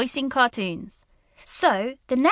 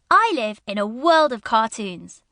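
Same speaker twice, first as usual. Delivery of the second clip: usual speaking voice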